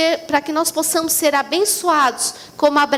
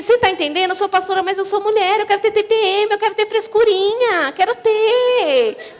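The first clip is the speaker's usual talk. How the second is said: normal talking voice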